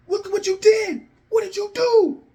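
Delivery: very high, strained outcry; shocked yelling. Acted. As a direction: cry out in horrified disbelief. Shocked Jay yelling at Solo.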